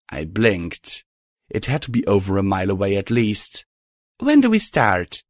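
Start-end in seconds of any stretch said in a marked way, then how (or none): 4.2-5.2 higher, scoffing; snorting scornfully. A narrator's reading. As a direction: scoff it out impatiently. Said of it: "I snorted".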